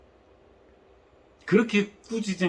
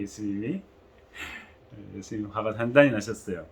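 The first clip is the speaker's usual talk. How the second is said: normal talking voice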